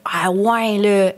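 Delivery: lightly put-on Québécois accent; a touch of thicker Québécois accent. Shown as a demonstration. Showing how she talks with some people, mild Québécois accent. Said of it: "je parle beaucoup plus québécois".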